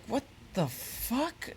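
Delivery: high, slow, drawn-out disbelief; stretched, high-pitched retold bewilderment; totally confused. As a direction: imitate a baffled grandmother, softly and slowly. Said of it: "she was totally confused".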